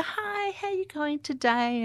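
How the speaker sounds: high, drawn-out sing-song greeting; slow down and lilt it high, as if to a child; sing-song child-directed voice. Shown as a demonstration. Interpreter showing how one greets a child, high sing-song voice. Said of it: "had that sing-song voice".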